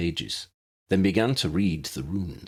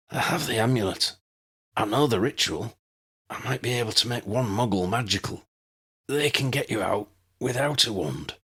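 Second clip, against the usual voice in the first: a hint of a weak young wizard, higher; lightly voiced dying young man; 4 semitones higher than usual. A narrator's reading. Dying Granville offers a plan, lightly voiced and frail.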